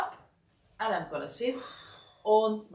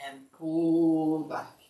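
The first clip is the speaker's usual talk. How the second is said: slow pull cue; 2 semitones lower, 5 dB louder, much slower than usual. Shown as a demonstration. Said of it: "coach paces the pull".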